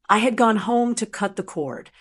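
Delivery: usual speaking voice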